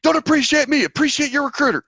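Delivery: high loud drill sergeant bark. Retold or imitated. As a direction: bark it at the recruit.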